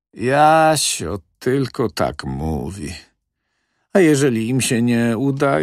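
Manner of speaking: lightly voiced worried old woman, slow at first; a fretful old lady, drawn out. A hint of the old doctor's widow worrying to the manager.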